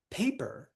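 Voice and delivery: PA-per, first syllable high, then falling; stress on first syllable. Shown as a demonstration. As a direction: punch the PA of Paper. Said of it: "stress is on the first syllable".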